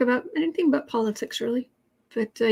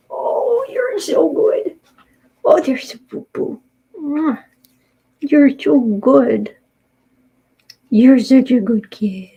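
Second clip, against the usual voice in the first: doting pet-owner praise, slow; loud pet praise; 6 dB louder, slower than usual. Real, not acted. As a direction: gush over the kitty, drawn out. Praising the cat.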